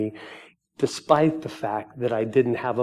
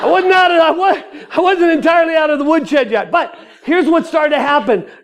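loud, high-pitched joyful testimony; exuberant preaching; 10 dB louder than usual. Real, not acted. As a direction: preach the good news at full force, laughter breaking in. Exuberant preaching at full force.

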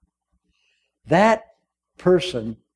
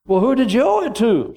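higher inquiring king; lightly voiced king; 6 semitones higher than usual. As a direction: ask it like a puzzled king. King questions.

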